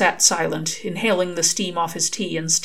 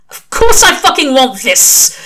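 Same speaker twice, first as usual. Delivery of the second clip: louder, higher outburst; exasperated shout; 8 semitones higher, 11 dB louder than usual. Acted. Crowley shouts back at the angel, loud outburst. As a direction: blurt it out in frustration.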